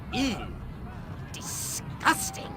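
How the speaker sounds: slow, whispered disgust. Acted. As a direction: let the disgust drag out. Reacts to gruesome sight.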